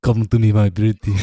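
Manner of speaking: low, luring villain voice; creepy villain. Pretend. Villain beckons a pretty one closer, low creepy voice. Accent turns into wicked character voice.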